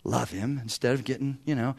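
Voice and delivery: soft, hushed retold prayer; quiet prayer. Son quietly prays for patience with his dad. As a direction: pray it under the breath. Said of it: "I started praying".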